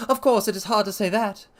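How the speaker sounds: nervous patient dodging the question. A narrator's reading. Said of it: "in a nervous fashion".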